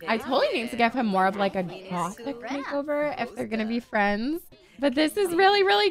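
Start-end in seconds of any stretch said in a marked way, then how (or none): none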